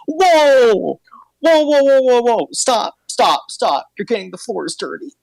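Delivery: high, yelping janitor cube crying stop; a startled high voice shouting whoa and stop; alarmed cube voice. Acted. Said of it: "you hear this voice, uh, come from the cube going like".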